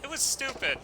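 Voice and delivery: put-on sheepish small boy apologising; young boy.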